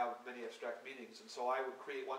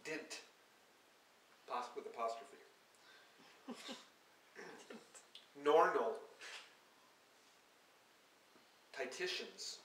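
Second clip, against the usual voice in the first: reading poem words; 2 semitones higher, 3 dB louder than usual. Acted. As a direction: let each word hang alone. Reads invented poem words aloud.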